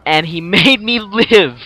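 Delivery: slow, loud, laughing through it; real laughing relief at surviving. Real, not acted. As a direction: laugh out his lucky escape.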